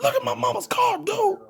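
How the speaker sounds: very high, strained squeal of a panicking friend, half laughing; a hammed-up high voice for the furious, scared friend; frantic friend flipping out. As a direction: shriek it like your mama's car almost got wrecked. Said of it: "Samo started going off on everybody".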